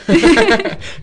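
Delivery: a high, bright burst of real laughter. Real, not acted. Laughing with friends about their own sing-song accent. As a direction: laugh out loud, high and free.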